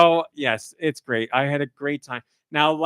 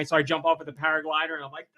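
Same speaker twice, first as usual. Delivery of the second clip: ordinary delivery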